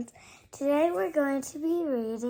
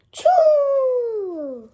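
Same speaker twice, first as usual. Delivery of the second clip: big fake sneeze; 10 semitones higher, 12 dB louder than usual. Gerald sneezes again.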